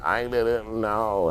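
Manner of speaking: high, drawn-out mock plea; mock whiny innocence. Beaten man in court with a sling, mock whining. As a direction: stretch it out in a high put-on victim voice.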